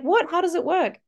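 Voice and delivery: put-on high, eager child questions; imitated curious kids. Classmates hearing about the magic wand, high imitated amazement. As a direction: ask in a high kid voice. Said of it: "they'll be like".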